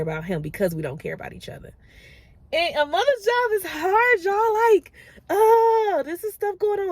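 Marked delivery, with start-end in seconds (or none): 2.5-6.9 crying it out, pitch cracking up; tearful mother overwhelmed, sobbing the words; real crying. Real, not acted. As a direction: voice breaking high into tears. Mother breaks down talking about kids.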